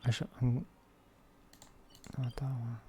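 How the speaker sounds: ordinary delivery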